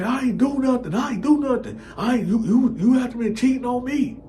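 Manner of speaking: high, panicky denial; desperate denial. Retold or imitated. Boyfriend pleads innocence to his girl, high and panicky. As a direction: deny it all in a high panic. Friend denies, high pitched.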